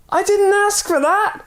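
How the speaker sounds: high, loud protest; indignant yell. Acted. Man yelling back in protest, high indignant yell. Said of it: "yelled the man".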